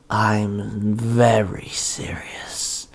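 very slow, low villain whisper; chilling whisper. Acted. Monster answers Ty's disbelief in a chilling whisper. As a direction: whisper it slowly, with icy menace. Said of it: "Jack Frost said chillingly".

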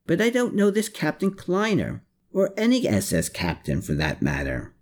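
mildly defensive denial. A narrator's reading. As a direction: protest it, starting high and settling down. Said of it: "again with some defensiveness".

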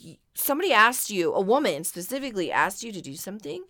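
normal talking voice

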